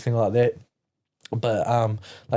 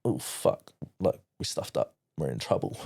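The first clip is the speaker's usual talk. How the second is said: scared muttered dread; 6 semitones lower, 5 dB quieter than usual. Retold or imitated. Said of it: "we're like".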